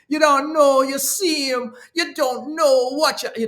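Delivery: high-pitched mock Jamaican woman; bad Jamaican accent. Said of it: "that's a horrible Jamaican accent".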